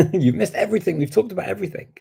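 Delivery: high, exclaiming with a laugh; mock outrage. Real, not acted. Host teasing a latecomer who missed everything, mock outrage.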